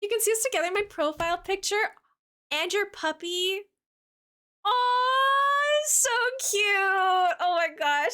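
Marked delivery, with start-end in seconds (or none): 4.6-8.1 gushing aw. Real, not acted. Reacting to viewer's puppy photo.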